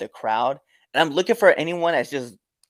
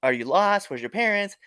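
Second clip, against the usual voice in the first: higher-pitched, lightly acted concern; mock concerned questioning; 8 semitones higher than usual. Wrestler asking a crying little girl if she's lost, concerned. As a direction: ask as if checking on an upset child.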